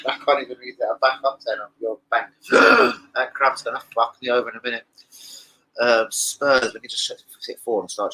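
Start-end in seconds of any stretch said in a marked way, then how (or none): 2.5-3.1 sudden high gasp of pain; a loud startled gasp; sharp gasp. Real, not acted. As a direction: catch your breath at a cramp. Sudden leg cramp.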